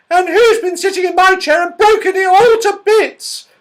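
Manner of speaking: very high, loud squeak on the edge of tears; a tiny bear wailing over his broken chair; squeaky tearful baby bear. A narrator's reading. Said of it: "squeaked the baby bear in tears".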